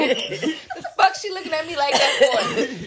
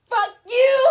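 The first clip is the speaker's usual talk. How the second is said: high, louder mock curse; mock yell; 13 semitones higher, 5 dB louder than usual. Imitating drivers cursing at the girl in the street, mock yell. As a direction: bark it like an annoyed driver.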